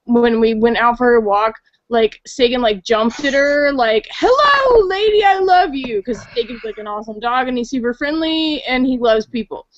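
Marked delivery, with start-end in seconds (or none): 4.1-6.0 much higher, eager dog voice; lightly voiced excited dog greeting a stranger; happy dog voice. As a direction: gush like an overjoyed puppy. Dog's thoughts voiced in story.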